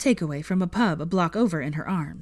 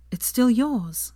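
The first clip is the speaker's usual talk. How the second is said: high, slow, deliberate insistence; pointed; 7 semitones higher, much slower than usual. A narrator's reading. Hermione reassuring Draco about his name, pointedly. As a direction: make the point, slowly and high. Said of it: "said Hermione pointedly".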